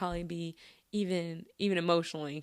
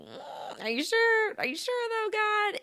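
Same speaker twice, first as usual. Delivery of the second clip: doubtful Gideon; slower than usual. Retold or imitated. Gideon asking God if he is sure, high and doubtful. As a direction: play Gideon doubting his calling. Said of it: "And he's like".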